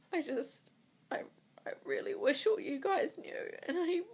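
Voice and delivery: high, strained voice through tears; struggling to speak while crying. Real, not acted. Woman confiding to viewers about her secret IVF cycle, crying high. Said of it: "crying my eyes out".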